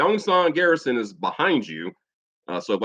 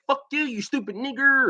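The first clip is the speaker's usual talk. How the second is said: mock angry insult; 14 semitones higher than usual. Storyteller mimics a bigot hurling a slur, mock angry. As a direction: imitate an angry bigot in a raised pitch. Acted quote of a racist insult.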